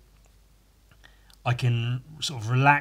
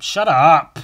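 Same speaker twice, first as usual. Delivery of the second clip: much louder joking shout at himself; mock shout; 2 semitones higher, 12 dB louder than usual. Real, not acted. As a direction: yell it to shut yourself up. Scolding himself, then shouting.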